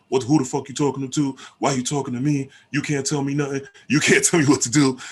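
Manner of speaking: loud, high, hammed-up tough guy; mock angry man. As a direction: play the macho man who won't be told, pitched high. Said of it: "he's not just".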